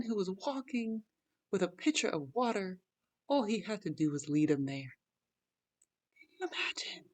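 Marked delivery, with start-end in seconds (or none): 6.2-7.0 unvoiced whispered aside. Real, not acted. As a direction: whisper it like a secret to the viewer. Rhetorical aside to the viewers.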